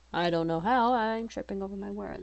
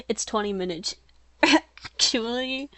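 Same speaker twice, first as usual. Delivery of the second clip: mock know-it-all; 4 semitones higher, 4 dB louder than usual. Reader hamming up Danny's muttered correction, mock know-it-all. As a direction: stretch 'actually' high, poking fun at the line. Exaggerated redo of muttered line.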